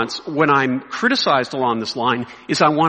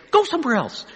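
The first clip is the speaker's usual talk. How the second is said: high, punchy mock send-off; bold dismissal; 9 semitones higher than usual. Pastor imagining himself telling a critic off, boldly dismissive. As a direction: relish sending the critic away.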